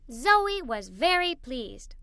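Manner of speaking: plain reading voice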